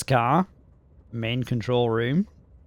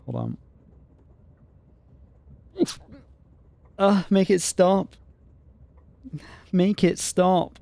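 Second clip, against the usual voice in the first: about to sneeze, strained; fighting back a sneeze; sneezing fit; 7 semitones higher, slower than usual. Real, not acted. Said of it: "Oh, I'm sneezing".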